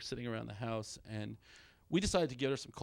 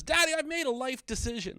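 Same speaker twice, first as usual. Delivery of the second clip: lightly voiced kid, higher and louder; son announcing; 11 semitones higher, 5 dB louder than usual. Son tells dad his big news, lightly voiced kid. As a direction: announce it like an earnest child. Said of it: "my oldest son, when he was twelve".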